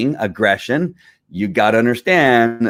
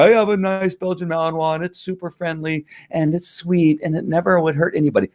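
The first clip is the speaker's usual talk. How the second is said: gushing dog owner; 8 semitones higher than usual. Retold or imitated. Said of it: "people are gonna go".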